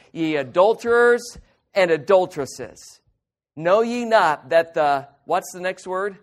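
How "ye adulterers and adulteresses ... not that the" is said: emphatic scripture reading. Shown as a demonstration. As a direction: thunder out the accusation "adulterers". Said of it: "Extremely strong language in verse four".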